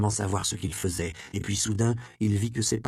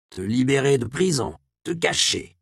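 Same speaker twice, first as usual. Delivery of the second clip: higher, louder indignant outburst; angry old monk; 5 semitones higher, 5 dB louder than usual. Acted. The abbot scolds Andreas in anger, higher and louder. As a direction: burst out at him in anger. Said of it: "d'une violente colère".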